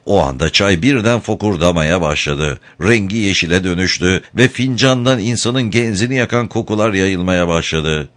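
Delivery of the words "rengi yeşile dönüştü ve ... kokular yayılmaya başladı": ordinary delivery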